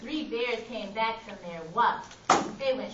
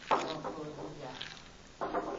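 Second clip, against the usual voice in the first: quiet; 10 semitones lower, 7 dB quieter, much slower than usual. Real, not acted. Aside to someone else.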